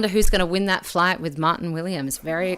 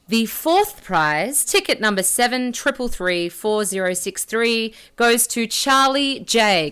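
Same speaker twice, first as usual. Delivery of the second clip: booming prize announcer; 3 semitones higher, 5 dB louder than usual. Acted. Raffle winner announced slowly.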